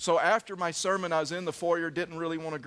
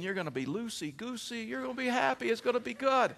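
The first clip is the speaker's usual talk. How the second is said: high, giddy sales pitch, imitating the doctor; upbeat promise; 7 semitones higher than usual. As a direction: promise bliss in a lilting high voice. Doctor promising the pill's blissful effect.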